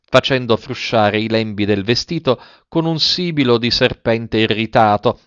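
ordinary delivery, nothing marked

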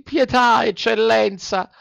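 high, begging cry for mercy; pleading. A narrator's reading. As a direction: beg at his feet. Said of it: "con voce supplichevole".

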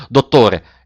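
a sudden frightened call; agitated cry. A narrator's reading. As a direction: cry out in alarm. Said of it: "esclamò con voce concitata".

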